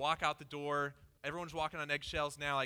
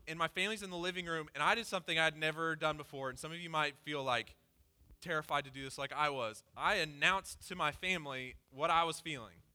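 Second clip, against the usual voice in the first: normal talking voice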